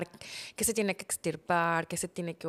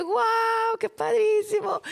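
much higher, louder delighted cry; excited; 13 semitones higher, 6 dB louder, slower than usual. Retold or imitated. Wife hearing the baby is a girl, thrilled exclamation. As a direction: burst out with joy at the news. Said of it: "Yo dije".

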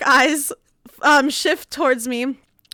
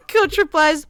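ordinary delivery

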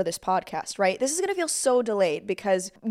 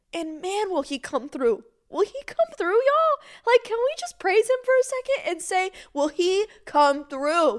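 much higher, soaring cry of praise; ecstatic praise; 8 semitones higher than usual. Real, not acted. Host urging listeners to praise, ecstatic and high. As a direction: lift it up like a shout of joy.

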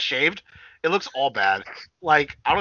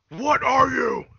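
mock interrogation; 13 semitones higher, 4 dB louder, much slower than usual. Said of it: "put us in the voice clip".

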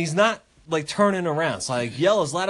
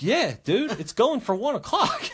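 mock exasperation; 11 semitones higher than usual. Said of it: "I'm like".

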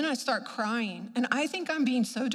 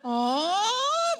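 high, rising drawn-out moan; exaggerated "oh"; 8 semitones higher, 4 dB louder than usual. Patient overdoing the movement, exaggerated rising cry. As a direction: swoop the sound upward, hammed up.

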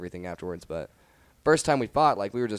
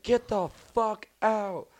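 high, drawn-out mock scream; retold scream; 13 semitones higher, 4 dB louder, much slower than usual. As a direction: act out screaming at a house full of guests. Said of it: "I'm, like, screaming".

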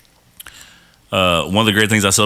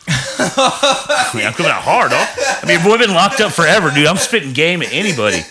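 laughing; 8 semitones higher than usual. Real, not acted. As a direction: chuckle while bragging. Laughing about flirting after prison.

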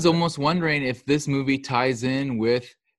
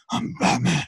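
mock superhero growl, pitched up; 5 semitones higher than usual. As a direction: put on a fake tough-guy voice, poking fun.

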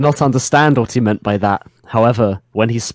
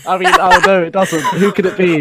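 laughing sarcasm; 7 semitones higher, 5 dB louder than usual. Real, not acted. Mocking obvious villain.

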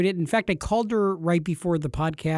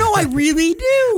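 squealing fan; 6 dB louder than usual. Pretend. Mock fan insisting.

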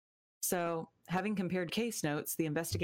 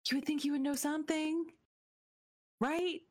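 incredulous; 12 semitones higher than usual. Real, not acted. Incredulous about the suspect.

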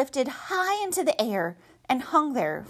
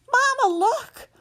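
stifled squeal; 14 semitones higher, 5 dB louder, slower than usual. Acted. Gosling lifted by wind.

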